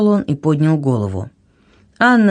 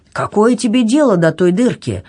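cross king, raised pitch; an indignant rebuke, pitched up; angry exclamation; 6 semitones higher than usual. A narrator's reading. King snapping at a girl over his hole, angry. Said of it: "сердито воскликнул король".

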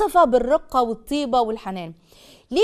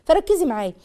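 ordinary delivery

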